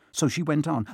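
usual speaking voice

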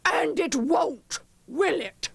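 high, pointed witch voice; warning witch. A narrator's reading. Substitute warning the class, pointed. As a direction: press the class for agreement.

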